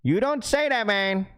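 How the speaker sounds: high-pitched dad reprimand; dad's concerned scolding. Acted. Streamer imitating dad telling off chat, mock concern. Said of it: "That's what my dad would say to y'all".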